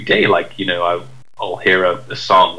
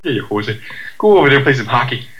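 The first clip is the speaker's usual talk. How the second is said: put-on Canadian accent; 5 semitones higher, faster than usual. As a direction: tease like a hoser Canadian. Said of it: "man of a million accents".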